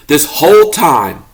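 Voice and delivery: plain reading voice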